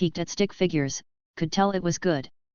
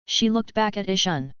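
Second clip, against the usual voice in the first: usual speaking voice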